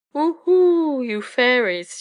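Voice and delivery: loud, high owl hoot; high drawn-out call, easing on the last words; crying out. A narrator's reading. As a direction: hoot loudly, calling the fairies out. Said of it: "she cried".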